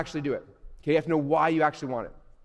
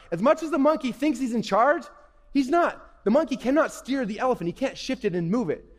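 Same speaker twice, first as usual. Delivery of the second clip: normal talking voice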